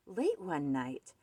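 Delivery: ordinary delivery, nothing marked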